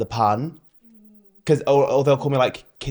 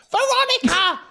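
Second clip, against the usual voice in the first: high, loud mock holler; hurrying yell; 4 dB louder than usual. Queen retells the call to Veronica before the runway, hurrying yell.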